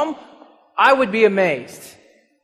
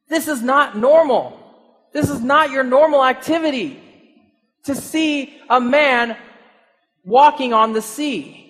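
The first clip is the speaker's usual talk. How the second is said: ordinary delivery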